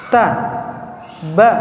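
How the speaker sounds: normal talking voice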